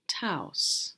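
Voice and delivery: ordinary delivery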